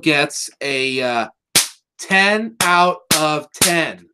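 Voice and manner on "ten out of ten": very slow, word by word; deliberate verdict. Real, not acted. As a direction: announce the score with weighty gaps. Ceremonial reveal of the score.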